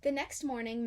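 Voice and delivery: ordinary delivery, nothing marked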